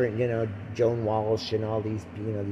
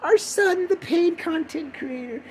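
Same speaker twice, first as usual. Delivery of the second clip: high-pitched, drawn-out mock parental pride; hammed-up proud parent impression; slower than usual. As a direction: gush in an exaggerated high voice. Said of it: "My mom and dad would be so proud".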